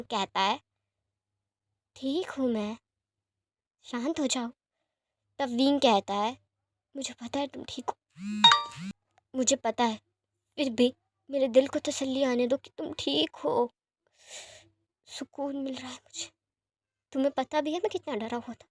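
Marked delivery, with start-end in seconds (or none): 2.0-4.5 softer, frail patient just waking up; hushed, tired reassurance from a hospital bed; weak soft voice. Acted. Lan Jan calms Wing softly. 7.0-18.7 softer, relieved and tender; a lover softly admitting how scared he was; tender relief. Acted. Wing relieved, holding Lan Jan.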